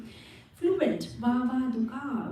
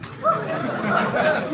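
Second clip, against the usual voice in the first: high laugh bursting out mid-story; bright laugh; 11 semitones higher, 7 dB louder than usual. Real, not acted. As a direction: laugh loudly at your own joke. Laugh inside Krishna's teasing.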